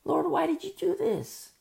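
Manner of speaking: high-pitched mock whine; murmuring and complaining. Believer asks the Lord why, high complaining whine. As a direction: whine upward in protest. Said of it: "More murmuring and complaining".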